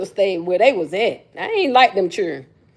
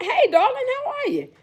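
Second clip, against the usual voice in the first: sweet high greeting; 13 semitones higher, 4 dB quieter than usual. Real, not acted. Greeting viewers in live chat.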